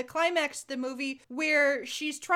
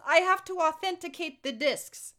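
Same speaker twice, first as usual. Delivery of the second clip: higher, hammed-up Russian accent; mock Russian accent; 4 semitones higher than usual. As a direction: quote the spy in a bad Russian accent. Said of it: "And she goes".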